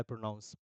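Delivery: "प्रोनाउंस" said as the mistaken word for pronunciation; mock the common slip "प्रोनाउंस" in a low murmur; wrong word. Shown as a demonstration. Teacher mimicking students who say "प्रोनाउंस". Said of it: "बहुत सारे लोग बोलते हैं".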